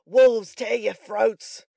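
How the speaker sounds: loud, drawn-out savage curse; ferocious growl. A narrator's reading. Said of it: "muttered Sikes, grinding his teeth".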